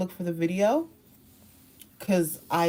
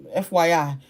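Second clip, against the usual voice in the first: usual speaking voice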